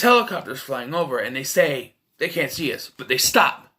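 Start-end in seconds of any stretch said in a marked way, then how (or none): none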